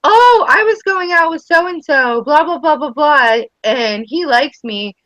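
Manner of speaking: high, drawn-out imitation of a young girl; daughter's boast. Mom mimicking her daughter bragging about a boyfriend, playful high imitation. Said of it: "'cause she says".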